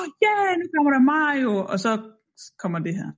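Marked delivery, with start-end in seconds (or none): 0.0-1.5 high-pitched excited fan cheer; mock excitement. Playing a viewer hearing the Mario melody, excited. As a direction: act out a thrilled viewer, pitched way up.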